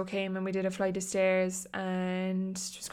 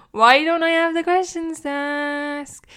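exasperated outburst; 7 semitones higher, 10 dB louder than usual. Real, not acted. Exasperated at herself.

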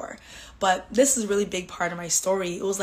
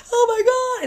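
mock hysterical wail; 7 dB louder than usual. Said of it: "hysterically crying".

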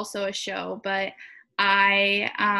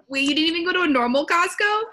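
surprised; 8 semitones higher, 6 dB louder, faster than usual. Real, not acted. Learns about business Costco.

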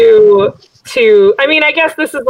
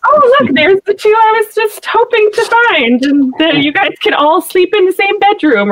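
high, sing-song mock delight; 10 semitones higher than usual. Mocking the cartoon's convenient Borders scene, high sing-song. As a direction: send up the line with fake cheer.

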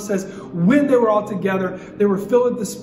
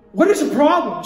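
higher, louder annoyed challenge; indignant retort; 6 semitones higher, 5 dB louder than usual. Retold or imitated. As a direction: snap back at the waitress in disbelief. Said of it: "I'm like".

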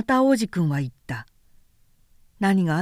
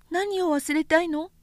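put-on light, high boyish voice, curious; a small boy asking innocently; little prince voice; 9 semitones higher than usual. A narrator's reading. Little prince asking the vain man, high childlike voice.

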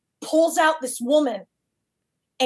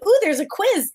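excited; 8 semitones higher than usual. Retold or imitated. Spotting a quiz in the paper.

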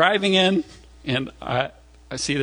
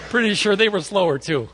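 ordinary delivery

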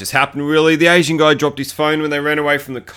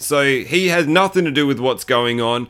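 ordinary delivery

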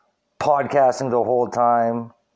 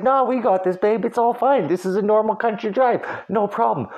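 put-on higher, falsely breezy reassurance to his girlfriend; mock calm reassurance; 10 semitones higher than usual. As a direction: pretend everything's fine while terrified. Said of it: "I was like".

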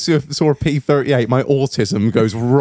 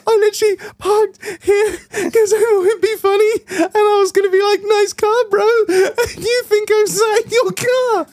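very high, squeaky, tearful laughter in the voice; helpless laughing explanation. Retold or imitated. Explaining the prank to the car owner, helplessly laughing. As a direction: squeal it out while cracking up.

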